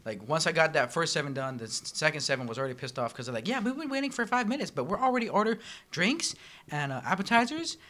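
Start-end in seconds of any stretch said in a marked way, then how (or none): 3.5-7.8 high whiny customer. Retold or imitated. Voicing impatient customers.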